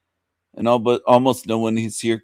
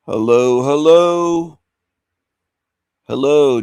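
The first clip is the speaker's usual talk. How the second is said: loud hello rising higher on the second; calling out; 3 semitones higher, 6 dB louder, slower than usual. Real, not acted. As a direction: call out, then lift the second hello. Calling out to silent viewers.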